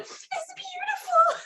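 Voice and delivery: slow, very high weepy squeak; mock tearful. New fiancée admiring the ring through tears, mock tearful. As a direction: imitate being choked up, drawn out high. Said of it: "red in the face and I'm, like, puffy".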